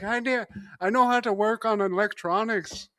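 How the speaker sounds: high-pitched comic Irish job seeker; badly faked Irish accent, amused; mock Irish accent. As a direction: ask in a silly, high Irish brogue. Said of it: "three things in an Irish accent".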